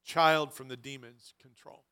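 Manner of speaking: ordinary talk, nothing marked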